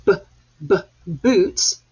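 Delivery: "b, b, boots" with the b sound isolated first. Shown as a demonstration. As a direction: tap out the b sound then the word. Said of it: "So when you say".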